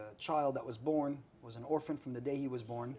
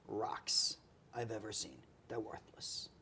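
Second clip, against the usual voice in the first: whispered slowly, dropping to nothing; slow hushed reveal; hushed verdict; 5 semitones lower, 7 dB quieter, slower than usual. Retold or imitated.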